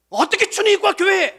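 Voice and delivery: loud, high indignant cry; mock outrage. Pastor mimicking the church's outrage, loud and high. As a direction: blow up in mock disbelief.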